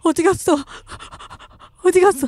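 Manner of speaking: jumpy searching voice; startled. Real, not acted. Startled searching in horror game. (놀라서 높아진 목소리)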